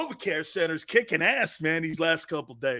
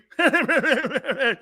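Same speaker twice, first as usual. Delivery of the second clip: higher mock chuckle; fake radio laugh; 7 semitones higher, 4 dB louder than usual. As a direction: toss off a phony on-air laugh. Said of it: "Those fake-ass laughs".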